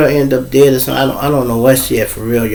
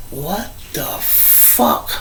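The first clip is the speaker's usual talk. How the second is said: slow, breathless whisper of shock; whispered in disbelief as she stands; stunned disbelief; 5 semitones higher, 12 dB quieter, much slower than usual. Retold or imitated. Seeing the woman stand up, a slow stunned whisper.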